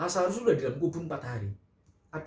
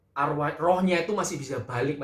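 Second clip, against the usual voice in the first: usual speaking voice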